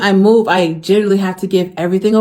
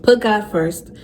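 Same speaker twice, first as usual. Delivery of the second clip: usual speaking voice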